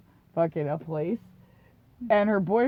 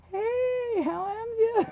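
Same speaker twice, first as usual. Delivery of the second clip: put-on much higher, goofy drunk hello; sheepish drunk greeting; 13 semitones higher, much slower than usual. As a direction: greet him like nothing happened. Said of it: "I'm like".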